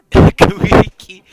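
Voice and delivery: loud, high, laughing repeat; laughing disbelief. Real, not acted. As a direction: crack up while repeating the silly phrase slowly. Cracking up at silly game phrase.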